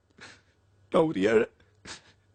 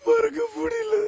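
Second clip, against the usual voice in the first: breaking into a sob; 10 semitones higher, 3 dB quieter, slower than usual. Acted. Heartbroken man to counselor, breaking into a sob. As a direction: voice cracks high into a sob.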